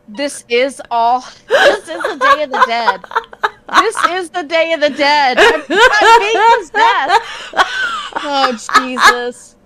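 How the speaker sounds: hammed-up possession, breaking into laughs; laughing mock panic. Joking she is possessed.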